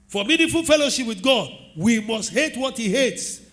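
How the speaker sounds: normal talking voice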